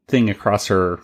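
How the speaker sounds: normal talking voice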